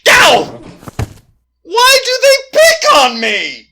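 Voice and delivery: high, loud whiny complaint; pained cry. Acted. Victim whining after a ghost kick, high and loud. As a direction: yelp and whine after being kicked.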